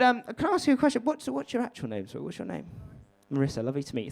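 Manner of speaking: ordinary talk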